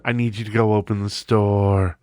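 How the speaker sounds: droning, drawn-out boss voice; imitated former boss. Boss phoning a sleeping teenage employee, droning drawn-out imitation. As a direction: drone the order out like the old boss. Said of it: "he used to talk like this".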